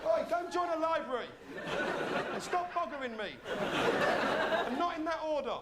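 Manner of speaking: high, loud telling-off; indignant shout. Pretend. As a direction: bark it over your shoulder. Yelling at man over shoulder.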